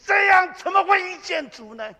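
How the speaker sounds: shouted high, challenging the listeners; rhetorical outcry. Acted. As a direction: fling the question at the room. Pastor's rhetorical challenge to congregation.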